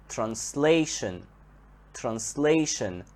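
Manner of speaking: "translation" slowly, SLA stressed and stretched; stress on second syllable. Shown as a demonstration. Teacher shows verb turned noun, SLA in "translation" stressed slowly. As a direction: draw out the middle of "translation": tran-SLA-tion. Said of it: "the stress here comes on the second syllable".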